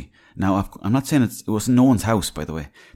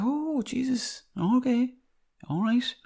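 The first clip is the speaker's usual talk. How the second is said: much higher, slow, dumbfounded; surprised; 3 dB quieter, slower than usual. Retold or imitated. Startled when the radio man speaks plainly in the shop, high and slow. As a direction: caught off guard, stretch it out up high. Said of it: "And I said".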